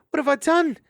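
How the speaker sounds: high, loud anguished wail. Acted. Mariel over the stunned hedgehog, wailing. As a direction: cry out in dismay. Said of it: "she wailed".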